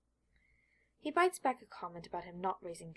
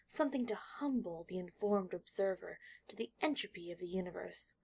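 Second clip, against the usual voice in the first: ordinary delivery, nothing marked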